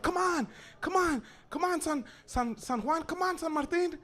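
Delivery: high-pitched calls to hurry; urging on. Acted. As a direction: egg your friends on from across the fence. Boys urging friends over fence.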